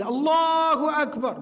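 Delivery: high, drawn-out call to prayer; loud adhan call. Shown as a demonstration. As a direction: call it out long and high, as if across open hills. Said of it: "Call the adhan loudly".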